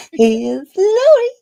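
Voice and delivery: high, loud, slow host announcement; announcer intro. Shown as a demonstration. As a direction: belt it like a game show host. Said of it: "you just did a good job there".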